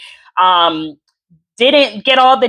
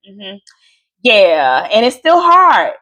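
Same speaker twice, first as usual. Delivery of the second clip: normal talking voice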